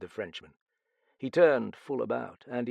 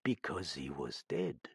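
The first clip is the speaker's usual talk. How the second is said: low, soft Frenchman's answer; quietly; 8 semitones lower, 7 dB quieter than usual. A narrator's reading. Detective answering the shouted question, quiet and grave. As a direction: drop the revelation quietly. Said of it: "said Benquelin quietly".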